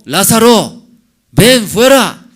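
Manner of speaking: a loud, high cry calling the dead man out; commanding shout. Acted. Preacher voices Jesus calling Lazarus. (grito fuerte y agudo, ordenando salir)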